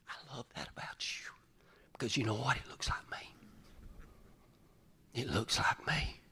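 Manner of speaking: soft tender whisper. Acted. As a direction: whisper it like a loving father. Father voiced tenderly.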